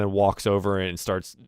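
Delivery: ordinary talk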